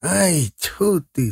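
lightly voiced old watchman's disgust; old man spitting. A narrator's reading. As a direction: spit it out like a fed-up old man. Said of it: "сплюнул старик".

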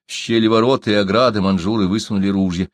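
plain reading voice

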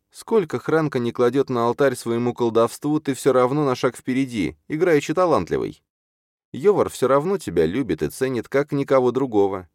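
put-on high, earnest young character voice; insistent Чарна. Чарна tells Юрген he outdoes Хранка, insistent.

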